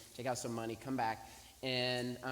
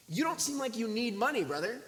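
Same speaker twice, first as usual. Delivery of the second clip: high, incredulous challenge; sceptical disbelief; 9 semitones higher, 4 dB louder than usual. Retold or imitated. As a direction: question his hard-luck story in exaggerated doubt.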